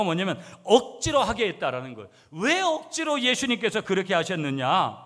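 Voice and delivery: high-pitched, insistent preaching; forceful preaching. Real, not acted. Preacher explains why Jesus compelled disciples, preaching forcefully. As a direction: press the point home from the pulpit.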